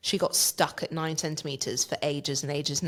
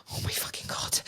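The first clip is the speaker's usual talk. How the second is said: whispered groan of despair; whispering in despair about a twenty-minute wait; pained whisper; 5 dB quieter than usual. Retold or imitated. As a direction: whisper it like you're dying of pain.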